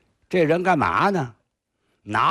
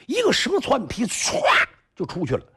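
plain reading voice

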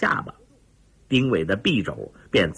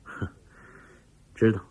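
slight smile; 3 semitones lower, 4 dB quieter than usual. A narrator's reading. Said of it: "上尉微微一笑".

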